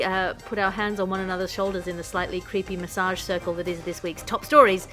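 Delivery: usual speaking voice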